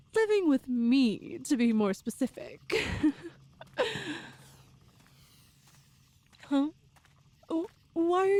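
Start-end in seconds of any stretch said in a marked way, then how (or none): 3.6-4.2 short high giggle; light giggle. Acted. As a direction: giggle lightly at his confusion. Amused at listener's confusion.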